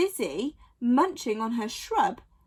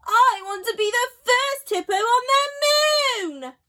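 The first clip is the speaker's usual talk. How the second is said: high, loud announcing hippo; excited hippo voice; 9 semitones higher, 6 dB louder than usual. A narrator's reading. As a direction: Sheila proclaims her dream to friends, high and loud. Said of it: "she announced".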